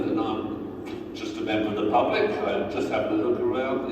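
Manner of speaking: ordinary delivery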